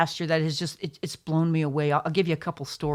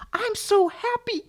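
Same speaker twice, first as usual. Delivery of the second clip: imitating elderly lady. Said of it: "She goes".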